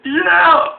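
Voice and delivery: high, loud mock yelp; imitating wife with a loud high yell. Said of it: "She's like".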